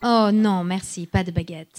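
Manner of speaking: speaking real French; polite French refusal. Shown as a demonstration. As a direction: native French phrase. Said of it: "if I'm offering you a baguette".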